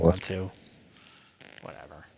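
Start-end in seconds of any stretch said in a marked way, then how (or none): 1.6-2.0 breathy dismissive whisper to end the rant; whispered whatever. Real, not acted. He waves off his own rant to his cohost, whispering. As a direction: let it go in a soft whisper.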